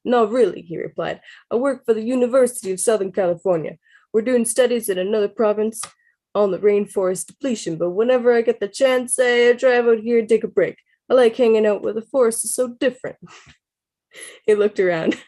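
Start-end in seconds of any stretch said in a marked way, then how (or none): 0.1-0.6 put-on Brooklyn accent. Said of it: "How's, how's my Brooklyn accent, guys?". 1.5-13.2 higher, louder Brooklyn scientist; Brooklyn accent. Acted. Scientist explains his research to the narrator in Brooklyn accent. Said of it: "How's, how's my Brooklyn accent, guys?".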